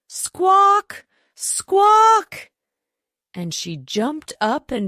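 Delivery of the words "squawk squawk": very high, loud panicked squawks; terrified squawking. A narrator's reading. Chicken Little squawks louder in terror while fleeing. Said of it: "her call rising louder with her terror".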